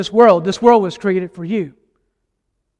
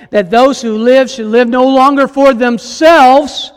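slow, forceful, raised sermon voice; climactic preaching; 5 semitones higher, 7 dB louder, slower than usual. Acted. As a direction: proclaim it, drawing out key words.

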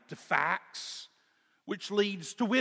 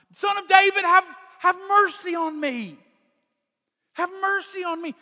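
much higher, much louder, begging; desperate pleading shout; 11 dB louder than usual. Retold or imitated. Blind beggar calling Jesus over the crowd, pleading shout. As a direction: scream for mercy over the crowd. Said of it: "And he cries out".

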